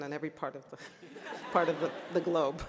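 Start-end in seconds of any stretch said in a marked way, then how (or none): 1.5-2.6 chuckling through the words, a bit louder; laughing. Real, not acted. Speaker to graduates, amused by her own joke, laughing. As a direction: laugh as you finish the joke. Joking about Jamaicans everywhere.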